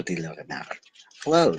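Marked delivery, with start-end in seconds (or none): 1.2-1.6 higher greeting fox; lightly voiced fox. A narrator's reading. The fox greeting the prince, lightly higher. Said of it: "said the fox".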